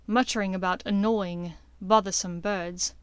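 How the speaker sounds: plain reading voice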